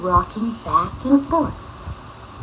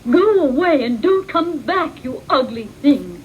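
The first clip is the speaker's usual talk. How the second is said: furious mother bird; 3 semitones higher, 4 dB louder than usual. Acted. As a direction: chase him off in a fury. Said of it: "was furious".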